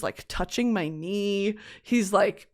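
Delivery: normal talking voice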